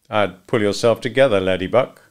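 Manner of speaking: ordinary delivery, nothing marked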